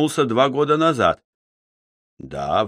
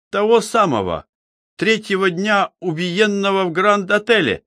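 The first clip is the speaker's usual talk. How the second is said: high-pitched, eager query; animated question; 9 semitones higher than usual. A narrator's reading. Chemist asks the investigator about the murdered man, animated question. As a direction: ask with lively surprise, pitched well up.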